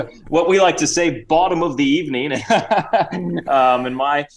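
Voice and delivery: put-on Cockney-Australian accent with chuckles; Sir Reginald. Guest explains his greeting in a mock Cockney-Australian accent. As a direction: play a chummy Cockney-Aussie gentleman. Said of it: "bit of a Cockney accent and Australian".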